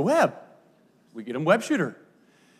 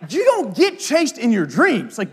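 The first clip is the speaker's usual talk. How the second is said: usual speaking voice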